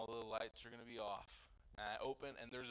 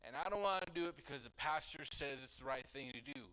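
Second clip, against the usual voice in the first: heated; 4 semitones higher, 7 dB louder than usual. Retold or imitated. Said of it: "I'm getting all heated it up".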